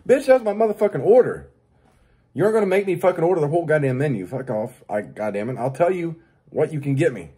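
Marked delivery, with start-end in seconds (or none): 0.1-3.5 angry retort. Retold or imitated. Angry answer to the cashier.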